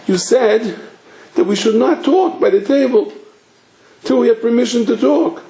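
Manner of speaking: put-on high, whiny little-boy voice; imitated crying child. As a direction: mimic a tearful child pleading his case. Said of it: "he's like crying".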